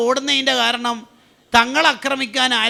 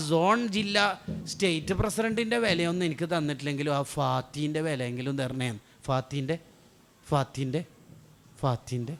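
soft hurt plea; 6 semitones lower, 6 dB quieter than usual. Acted. Allah's words voiced as a plea.

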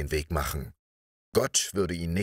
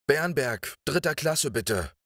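put-on boy's hasty call, pitched high; high, urgent request dropping at the end; 8 semitones higher than usual. A narrator's reading. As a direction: call the order to the ticket seller. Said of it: "rief er dem Billettverkäufer zu".

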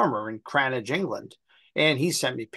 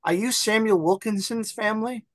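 put-on old lady voice; 8 semitones higher than usual. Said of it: "She goes".

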